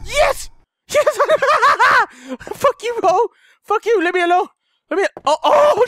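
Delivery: high, loud frantic shouts at the monster; yelling in panic as she lunges at him; panicked yelling. Real, not acted. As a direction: scream her off you in real panic. Jump scare, fending off Kiki.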